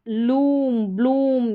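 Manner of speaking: लूम, ब्लूम with a drawn-out "oo"; long "oo" sound. Shown as a demonstration. Teacher shows students the long vowel, drawn-out "oo". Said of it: "यानी बड़े ऊ के रूप में".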